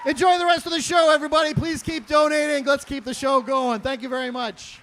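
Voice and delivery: big, high-pitched closing holler; shouted sign-off. Real, not acted. Comic leaving the stage to his audience, shouting thanks. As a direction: shout goodbye over the applause.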